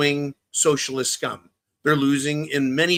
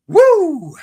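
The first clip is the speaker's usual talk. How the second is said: loud, high "woo"; excited whoop; 13 semitones higher, 9 dB louder than usual. Real, not acted. Livestream host wrapping up his plugs, whooping loudly. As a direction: let out a big high whoop.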